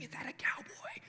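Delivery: breathy hushed whisper; students whispering. Retold or imitated. Students in the hallway whispering about the pastor, hushed. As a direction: whisper it behind his back. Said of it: "you hear them whispering".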